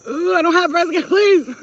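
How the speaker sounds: high, loud mock-offended squeal; shrieking denial. Real, not acted. To her viewers about bee stings, loud squealing denial. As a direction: loudly deny it with a laugh.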